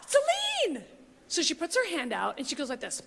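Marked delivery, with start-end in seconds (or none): 0.0-0.8 nervous starstruck gasp. Retold or imitated. A nervous fan meeting [name], a gasping cry. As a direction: gasp then call her name. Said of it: "I just go like this".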